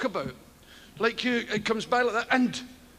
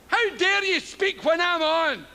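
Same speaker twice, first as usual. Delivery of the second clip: mock outrage; 8 semitones higher than usual. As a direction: play the offended celebrity scolding a heckler.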